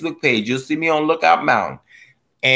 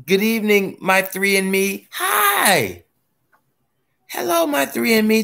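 high sing-song hello to followers; excited greeting; 9 semitones higher than usual. Real, not acted. Streamer welcoming viewers to the live, excited greeting.

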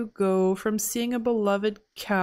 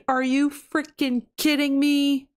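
usual speaking voice

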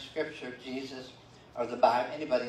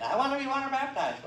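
high-pitched imitation of an eager child; little kid; 13 semitones higher, 3 dB louder than usual. Preacher imitating little kids eager for the baptism tank, high child voice. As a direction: mimic a little kid begging in a squeaky voice. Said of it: "all the really little kids wanted".

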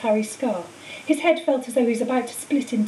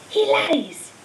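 the same cry again, higher and sharper; rising eerie accusation; 7 semitones higher than usual. A narrator's reading. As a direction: push the accusation up higher and harder. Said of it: "a high voice spoke".

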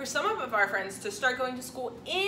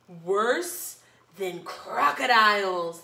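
ominous maid; 5 semitones lower, 4 dB louder, much slower than usual. Acted. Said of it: "said the maid".

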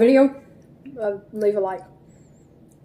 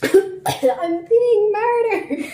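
high, slow mock wail; drawn-out melodramatic cry; dramatic wail; 11 semitones higher, much slower than usual. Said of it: "And you were like".

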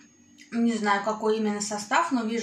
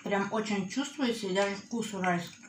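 talking between bites; slightly muffled by food; mouth partly full, chewing. Real, not acted. Seen 0: Chewing at -1.0, still talking with slightly full cheeks.

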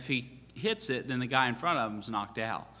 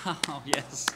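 fake enthusiasm; 3 dB quieter than usual. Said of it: "But it was fake".

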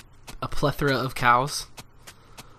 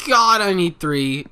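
loud, higher, slow exasperated groan; frustrated outburst; 6 semitones higher, 11 dB louder, slower than usual. Real, not acted. Gamer realising he lacks planks, exasperated outburst. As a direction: vent the annoyance.

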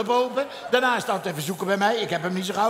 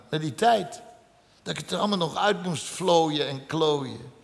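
wistful; 5 semitones lower than usual. Real, not acted. Nostalgisch, zacht slot.

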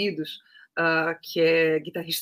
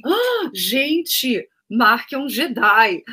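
amazed; 4 semitones higher, 5 dB louder, much slower than usual. Retold or imitated. Narrator retells own remark.

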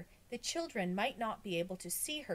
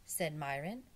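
ordinary delivery

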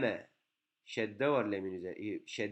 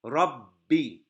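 Rabbi with the b held and hit hard; stressed double b; 2 semitones higher, 8 dB louder than usual. Shown as a demonstration. Teacher models Rabbi for students, leaning hard on the b. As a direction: lean on the doubled b in Rabbi, then release into i. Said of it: "B'ye dur vurgu yapıyoruz".

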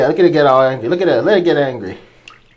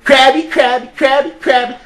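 high cooing sing-song, a bit louder; higher singsong pet-talk to a crab; baby talk; 8 semitones higher, 4 dB louder than usual. Acted.

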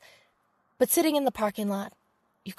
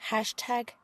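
whispered aside; 7 dB quieter than usual. A narrator's reading. Whispered hashtag.

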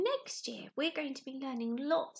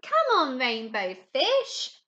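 calling out; 10 dB louder, much slower than usual. Acted. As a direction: shout him over to play. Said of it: "they called".

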